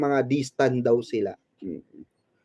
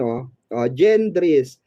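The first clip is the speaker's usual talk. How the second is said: ordinary talk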